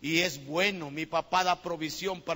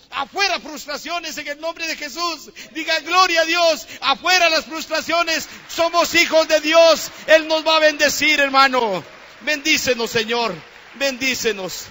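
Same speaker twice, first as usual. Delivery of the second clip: high, very loud exhortation; full-force preaching shout; 9 semitones higher, 15 dB louder than usual. Real, not acted. Preacher rebuking frustrations before the congregation, impassioned shouting. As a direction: shout it out with long, held words.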